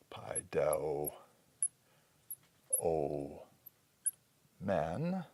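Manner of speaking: low, soft, drawn-out "pai do o men"; hushed piece-by-piece sounding out; syllable by syllable. Shown as a demonstration. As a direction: sound out "pai do o men" slowly with long gaps. Sounding out a Greek verb slowly.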